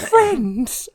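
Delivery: hammed-up crying on one word; mock tearful Riddler. Riddler answering the Joker's riddle, mock crying. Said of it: "as he's crying".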